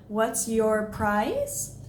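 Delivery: very slow, drawn-out rise on "price"; exaggerated question inflection. Shown as a demonstration. Coach demonstrates the voice going up, exaggerated question inflection. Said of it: "It goes up".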